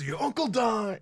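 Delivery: high-pitched wrestler catchphrase; playful wrestler impression; Macho Man. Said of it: "like, Macho Man or some shit".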